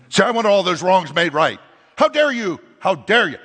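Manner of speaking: hammed-up furious God; divine rebuke. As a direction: thunder the outrage at them.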